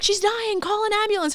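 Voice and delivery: high, alarmed call for help; panicked cleaning lady. Retold or imitated. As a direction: squeal it like a frightened cleaner.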